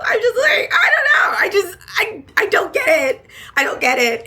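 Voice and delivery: very high, loud, half laughing rant; laughing exasperated wail; exasperated laughing cry. Real, not acted. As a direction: whine it out in disbelief. Ranting about dating shows.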